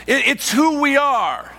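high, loud, driving sermon delivery; full-force preaching. Acted. Pastor to congregation, preaching at full force.

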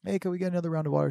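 high, quick, polite asking; imitating mom's sweet little request. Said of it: "very sweet".

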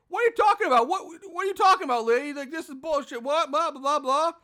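high, loud mock whine of disbelief; mock the guy squawking what are you talking about; mock incredulous yelling. Reviewer imitating Ryan protesting to Michelle, in a high loud mock yell. Mocking Ryan's complaints.

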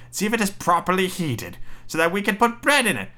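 high witch voice. Acted. Witch tricking Gretel toward the oven, high coaxing voice. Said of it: "said the witch".

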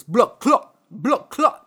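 put-on a rough stab at a Jamaican accent; butchered Jamaican accent, rising at the end. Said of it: "I'm not gonna do an accent 'cause I'll butcher it".

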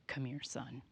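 a hint of a low, stern father; lightly voiced stern dad calling his son; serious dad voice. A mildly stern dad calls his son over, low. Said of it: "that sort of serious voice".

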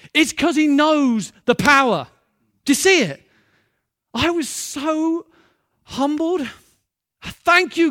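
loud, slowed emphatic preaching; preaching at full force. Real, not acted. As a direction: drive the point home with fervour. Preacher driving home his point.

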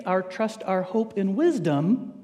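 ordinary talk

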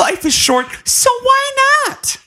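high, hammed-up carefree cry; mocking exclamation. Host ridiculing a dater's rationale, high mocking exclamation. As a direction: squeal it as a silly motto.

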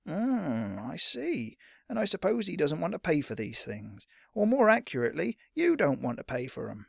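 dismissive, doubting merchant; sceptical shopkeeper. Acted. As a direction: let the suspicion show. Shopkeeper doubts boy will pay.

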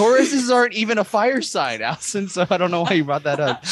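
high, teasing mock reprimand; mock scolding. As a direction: pretend to scold a friend.

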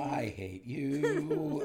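very slow, laughing mock hate; laughing I hate you. Real, not acted. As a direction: drag it out while laughing. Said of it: "mock hate".

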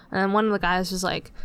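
usual speaking voice

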